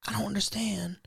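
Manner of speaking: whispered, baffled and helpless. Real, not acted. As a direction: breathe it out in quiet disbelief. Baffled by her panic attack.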